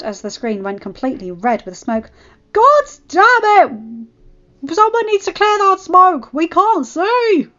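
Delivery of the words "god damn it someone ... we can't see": angry shouting. Acted. Angry fan shouting at smoke.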